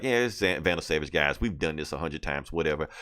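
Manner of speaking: low, dismissive shrug-off; lightly acted boredom, pitched low; blasé. Bored heroes shrug off villain.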